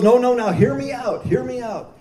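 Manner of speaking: high-pitched imitation of pleading; insistent. Warden urges the doubtful chaplain to listen, acted insistence.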